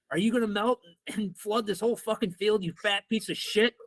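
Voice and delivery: high-pitched taunting retort; retold mouthy student insult; insulting comeback. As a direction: throw the question back as a jeer. Retold insult thrown back at coach.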